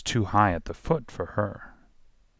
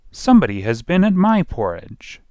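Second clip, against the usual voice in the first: lightly voiced middle-sized bear, higher and louder; 8 semitones higher, 6 dB louder than usual. A narrator's reading. Middle bear finds his porridge disturbed, loud middle voice. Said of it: "in his middle voice".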